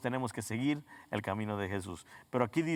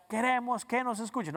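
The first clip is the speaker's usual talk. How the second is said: high-pitched imitation of protesters demanding; mock protest slogan; 9 semitones higher than usual. Preacher mimicking protesters with their signs, high mock demand. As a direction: chant it like a demonstrator, pitched well up. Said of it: "esos carteles para anunciar".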